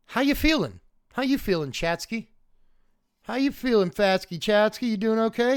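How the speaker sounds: pet talk. Real, not acted.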